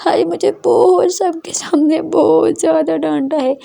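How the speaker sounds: a hint of sobbing as she confides; lightly acted crying. A narrator's reading. Daughter tells mother the teacher scolded her, a hint of tears. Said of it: "रोते-रोते अपनी mummy को हक करती है और बोलती है".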